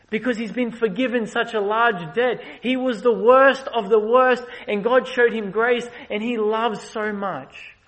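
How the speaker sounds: building sermon climax; preaching at full force. Acted. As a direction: preach it out to the congregation.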